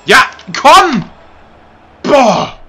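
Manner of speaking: berserk rage. Real, not acted. Raging at game.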